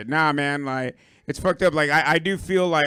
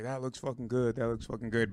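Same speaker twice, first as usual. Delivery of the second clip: longing murmur; 3 semitones lower, 9 dB quieter than usual. Retold or imitated. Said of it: "I'm like".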